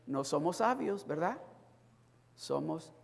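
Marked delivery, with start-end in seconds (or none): none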